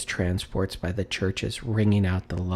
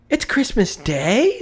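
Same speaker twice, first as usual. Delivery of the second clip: amazed echo; 12 semitones higher, 4 dB louder than usual. A narrator's reading. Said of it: "said Scrooge to himself".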